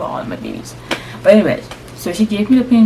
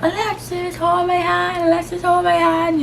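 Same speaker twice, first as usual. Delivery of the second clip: high-pitched repeated plea; calling out; 12 semitones higher than usual. Retold or imitated. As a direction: mock-desperate calling, held up high. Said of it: "I just calling out".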